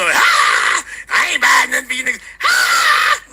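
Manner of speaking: high, fast hammed-up shriek; mock female shout. Host mocking women refusing to buy gifts, shouting high. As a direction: shriek it like an outraged woman, overdone. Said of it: "the females quick to say".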